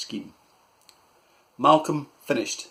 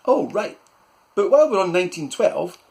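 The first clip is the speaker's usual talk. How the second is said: put-on higher, louder eager boy; boy's voice; 8 semitones higher, 7 dB louder than usual. Malcolm to his uncle, higher and louder. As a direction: pounce on the new topic.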